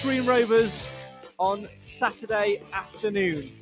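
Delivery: big, high radio sign-off voice; announcer outro. Acted. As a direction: sell the sign-off like a trailer. Show outro over music.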